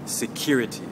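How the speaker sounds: "security" with the CURE syllable stressed; stress on second syllable. Shown as a demonstration. Teacher modelling a word for learners, second-syllable stress on "security". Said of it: "just a difference in the stress".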